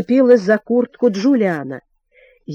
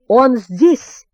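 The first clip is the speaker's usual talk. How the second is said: louder storybook exclamation; narrated shout, moderately raised; excited cry; 5 dB louder than usual. A narrator's reading. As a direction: call out the find to the others. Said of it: "крикнул Джулиан".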